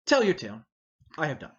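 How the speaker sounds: usual speaking voice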